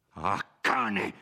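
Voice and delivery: loud, furious outburst; angry yell. A narrator's reading. Renzo curses the nobleman in an angry yell. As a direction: spit it out in rage. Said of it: "urlò Renzo".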